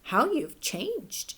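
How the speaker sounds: slow, drawn-out amazement; surprised. Acted. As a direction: let the surprise stretch each word. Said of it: "Dove was surprised when she saw Hen".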